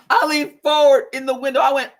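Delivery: laughing while telling it. Real, not acted. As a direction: giggle through the words. Retelling meeting her ex.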